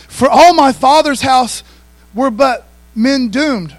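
slow, loud, high scripture proclamation; preached scripture. Acted. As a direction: pastor proclaiming the verse to the congregation, slow and loud. Reads scripture at full force.

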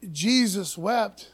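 slow and weighty, each word given room; solemn slow reading. Shown as a demonstration. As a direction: let the verse land slowly and reverently. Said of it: "powerful stick of dynamite verse".

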